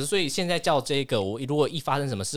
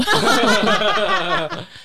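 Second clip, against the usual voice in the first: high, loud laughing fit; loud burst of laughter; 9 dB louder than usual. Real, not acted. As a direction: crack up loudly. Laughing after own joke. (大声爆笑)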